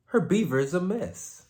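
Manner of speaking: normal talking voice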